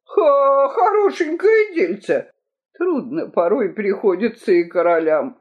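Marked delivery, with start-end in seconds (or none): 0.1-2.3 high-pitched, outraged scoffing; indignant scoff. A narrator's reading. King accused by his rival, scornful and indignant.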